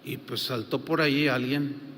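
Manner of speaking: ordinary talk, nothing marked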